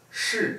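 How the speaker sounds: shi mit scharf fallendem vierten Ton; fourth tone. Shown as a demonstration. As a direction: shi kurz und fallend. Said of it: "Fourth tone".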